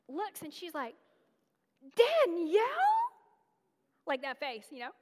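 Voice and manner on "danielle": high, drawn-out incredulous call of her name; surprised Danielle. Retold or imitated. Old classmate spots her on campus, incredulous high call. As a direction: act shocked to see her there.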